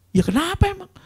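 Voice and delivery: very high, loud retort; acted alarmed question. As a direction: ask in exaggerated high-pitched alarm. Acted questioning of crying person. (bertanya heboh, dibuat-buat)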